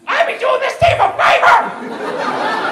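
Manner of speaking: slow, loud, high yell; drawn-out shouted outburst; furious comeback. Acted. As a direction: explode at the other parent. Yelled comeback to Meredith.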